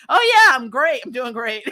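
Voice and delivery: fake cheerful.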